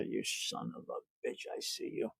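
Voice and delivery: hissed mock threat, unvoiced; whispered joking menace. Playful threat to viewer.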